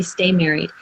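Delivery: ordinary talk, nothing marked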